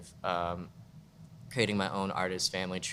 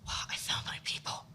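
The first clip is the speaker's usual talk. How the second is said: awed whisper; 6 dB quieter than usual. Retold or imitated. Whispering her amazement at finding her own community. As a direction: breathe it out in a hushed whisper. Retold inner reaction to video.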